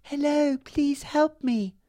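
tiny, high pleading fairy voice; very high, drawn-out little plea. A narrator's reading. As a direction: squeak out a small, slow cry for help. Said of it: "she heard a little voice say".

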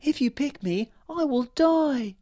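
tiny flower fairy. A narrator's reading. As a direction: plead to be spared. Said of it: "the flower fairy said".

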